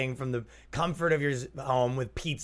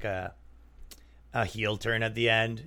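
usual speaking voice

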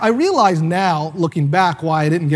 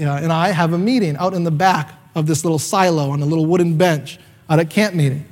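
ordinary delivery

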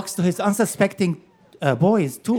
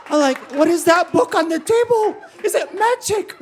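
put-on high, laughing kid's voice; a spooked eight-year-old blurting questions; scared child; 9 semitones higher than usual. Boy wondering what mother cooks, high and laughing.

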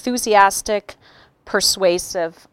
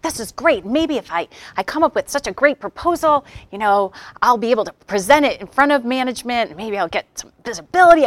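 eager, quick, higher inner voice; excited; 5 semitones higher, faster than usual. Retold or imitated. Trainer retelling her own eager plan, excited. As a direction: rattle it off, thrilled with the idea.

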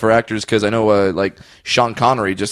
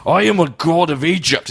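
light Scottish brogue as a pharaoh; Scottish accent; 5 semitones higher than usual. Acted. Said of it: "with a Scottish accent".

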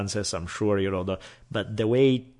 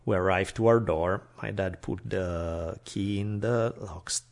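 usual speaking voice

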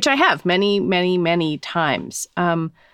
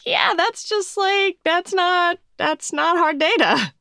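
high, brushing-off mock voice; dismissive; 10 semitones higher, slower than usual. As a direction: shrug the number off lightly. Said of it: "Would you be like".

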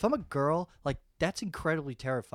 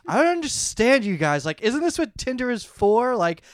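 indignant outburst; 4 semitones higher, 8 dB louder than usual. Retold or imitated. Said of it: "she was like".

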